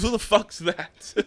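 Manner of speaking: laughing through a high, slow mock retort; cracking up while quoting him; laughing quote. To the group, retelling the coach's reply, laughing.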